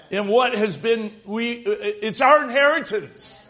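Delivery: forceful, emphatic sermon delivery; impassioned preaching. Real, not acted. Pastor vowing to the congregation he won't surrender, preaching hard. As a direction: preach it in driving, building phrases.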